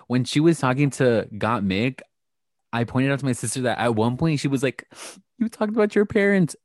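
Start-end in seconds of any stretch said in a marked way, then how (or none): 5.4-6.5 much higher, sing-song mock interviewer voice; imitating Rue's questions. Mocking the host as she probes a contestant about parents, high-pitched. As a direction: lift into a high, prying mock-tender tone. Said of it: "she was talking like this".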